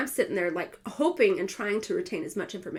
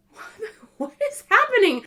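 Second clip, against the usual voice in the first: laughing disbelief; 11 semitones higher, 6 dB louder than usual. Retold or imitated. Bewildered being wheeled off.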